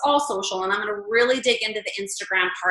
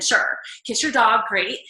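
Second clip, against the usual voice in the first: usual speaking voice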